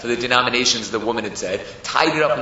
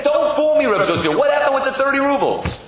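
high, loud, insistent challenge; exasperated demand; 13 semitones higher, 4 dB louder than usual. Acted. Maggid refusing to be fooled by Zusha, exasperated. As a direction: press him hard for the truth.

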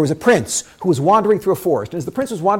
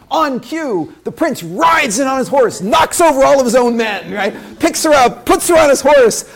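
rousing narration; 6 semitones higher, 5 dB louder than usual. A narrator's reading. Heroic rescue narrated.

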